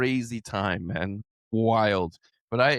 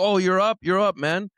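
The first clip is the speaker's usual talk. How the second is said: excited high friend; 8 semitones higher, 5 dB louder than usual. Retold or imitated. High friend calls the next morning.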